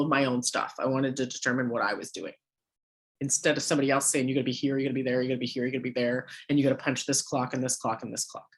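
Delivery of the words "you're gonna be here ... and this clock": quick monotone recital of mock orders; droning orders. Somebody else dictating her working day, mock droning. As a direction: rattle off the orders without variation. Mimicking a boss listing orders.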